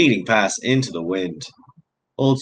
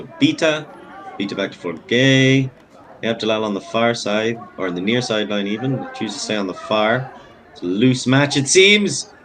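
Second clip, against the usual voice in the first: excited play-by-play; 3 semitones higher, 5 dB louder than usual. Real, not acted. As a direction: pitch and volume climbing as the move develops. Live play-by-play of a rising move.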